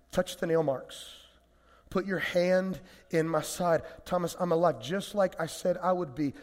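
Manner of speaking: soft, tender, reassuring Jesus; patient and loving. Acted. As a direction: speak gently like a friend calming him. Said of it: "very patiently and very lovingly says to Thomas".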